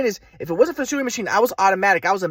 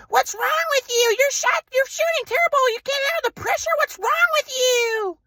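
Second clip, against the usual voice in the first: high mock mother's voice, drawn-out and scolding; shrill-free high impression of an angry mom; mom yelling. Mom scolding her son for his shooting, high mocking voice.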